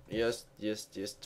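normal talking voice

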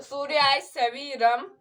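ordinary talk

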